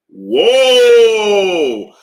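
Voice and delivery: loud, drawn-out cry of disbelief; shocked whoa. Real, not acted. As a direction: blurt it out, caught off guard. Shocked by the question's wording.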